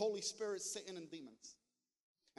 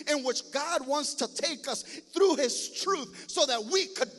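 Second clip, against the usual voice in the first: building to loud, impassioned preaching; fired-up preacher driving to a climax; excited preaching; 2 semitones higher, 8 dB louder than usual. Real, not acted. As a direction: preach it with mounting fervor, louder and louder. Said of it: "I'm getting excited".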